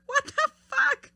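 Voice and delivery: high, loud, drawn-out disbelief; real stunned outburst, high and slow; shocked laughing outburst. Real, not acted. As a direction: cry it out slowly through shocked laughter. Shocked by the question card.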